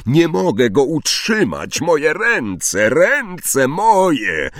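rising pained cry; panicked wail. A narrator's reading. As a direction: beg his master in pain. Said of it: "zawył Quirrell". (narastający, bolesny jęk)

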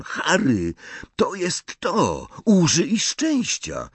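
high, slow, drawn-out eureka; excited realisation. A narrator's reading. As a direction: stretch each word as the idea dawns. Ron wpada na pomysł. (podekscytowane olśnienie, wysoko i przeciągle)

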